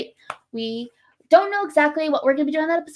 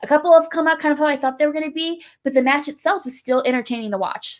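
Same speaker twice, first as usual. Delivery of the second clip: ordinary delivery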